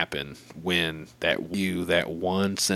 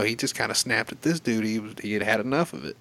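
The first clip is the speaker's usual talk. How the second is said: ordinary talk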